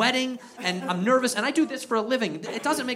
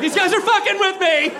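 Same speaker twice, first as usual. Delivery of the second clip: high, loud complaint; mock outraged yell; 11 semitones higher, 6 dB louder than usual. Tattling to imagined eagle rescuer.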